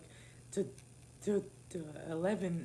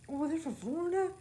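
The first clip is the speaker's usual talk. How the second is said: mock surprise; 9 semitones higher than usual. Retelling his acted interest.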